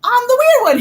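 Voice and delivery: high, loud, squeaky protest; mock-offended kid's cry, loud and high; outraged little boy. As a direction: play the wronged four-year-old, loud and high. Child outraged, retold with laugh.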